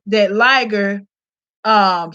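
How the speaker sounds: normal talking voice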